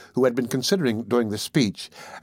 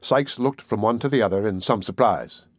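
ordinary delivery

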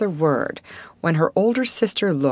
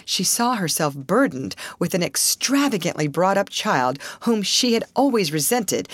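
usual speaking voice

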